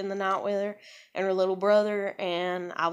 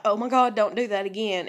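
pained outcry; 3 semitones higher, 7 dB louder than usual. Retold or imitated. Pain when daughter touches bruise.

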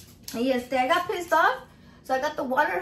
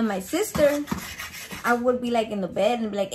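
usual speaking voice

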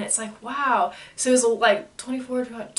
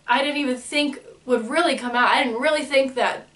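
normal talking voice

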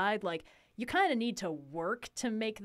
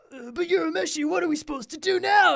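high, loud goofy basset hound voice; dopey cartoon voice; 7 semitones higher, 6 dB louder than usual. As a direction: do a dim-witted cartoon dog. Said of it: "Kuwabara being like".

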